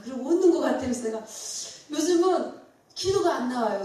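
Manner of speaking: normal talking voice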